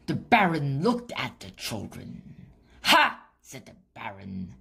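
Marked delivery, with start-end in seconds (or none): 2.8-3.2 gloating ha. A narrator's reading. Villain Baron gloating.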